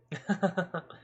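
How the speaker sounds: a put-on amused laugh; mock chuckle. Policeman laughs off the boy's rebuff, mock chuckle.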